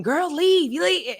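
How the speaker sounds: worried friend urging her to go; emotional pleading. Retold or imitated. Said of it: "but we're emotional".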